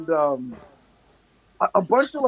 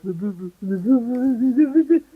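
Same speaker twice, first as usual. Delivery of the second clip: nonsense babble climbing in pitch; mock baby babble, rising and louder; baby talk; 4 semitones higher, 3 dB louder than usual. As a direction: babble it back as if to an infant. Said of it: "like you're talking to a baby".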